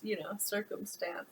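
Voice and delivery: plain reading voice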